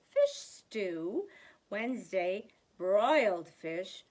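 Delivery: ordinary delivery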